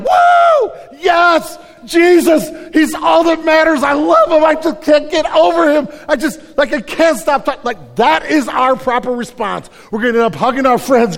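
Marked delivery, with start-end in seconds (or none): 0.0-7.6 shouting high with joy, all out; overjoyed outburst. Pretend. Believer bursting with joy over Jesus, ecstatic shouting. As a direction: burst out like you just heard the best news. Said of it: "and I'm gonna be like".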